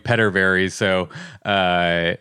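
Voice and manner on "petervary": Petterberry with short e, as in pet; mistaken PETTER pronunciation. Shown as a demonstration. Host admitting his old mistake, Petterberry with short e. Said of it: "I've been pronouncing it wrong forever".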